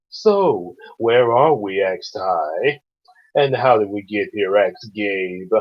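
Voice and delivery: low and smooth, stretching the line ends; Scar. Acted. Reader doing the story as Scar, smooth and low. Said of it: "I'm going to swoon with Star, with Scar".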